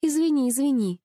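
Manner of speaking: high, hurried-in apology of surrender; conceding heroine, high voice; giving in. A narrator's reading. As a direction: give in and apologise, pitched high. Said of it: "сдалась Женя".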